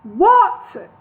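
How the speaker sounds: high, loud holler. A narrator's reading. The dwarves hollering at Gandalf in unison. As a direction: bellow it together. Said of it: "three dwarves hollered in unison".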